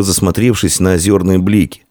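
plain reading voice

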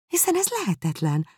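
"hiszen ez lehetetlen" with ez leaping high; incredulous. Acted. Jane disbelieving.

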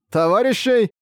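high, scoffing echo of the word; scornful demon. A narrator's reading. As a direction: sneer the word back at him.

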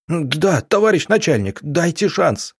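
high, lamenting cry for mercy; wailing plea. Acted. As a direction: wail and beg. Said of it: "запричитал задержанный".

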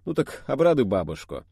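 plain reading voice

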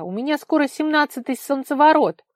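loud, high, indignant insistence; heated protest. A narrator's reading. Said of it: "вспылила Вара". (запальчиво, громко, высоким голосом)

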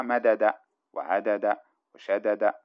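"مدد وعدد وشدد" slowly, as madada, ʿadada, shadada; unmerged letters. Shown as a demonstration. Teacher shows the original forms, unmerged letters. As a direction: sound both د separately in "مدد وعدد وشدد". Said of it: "فأصلها".

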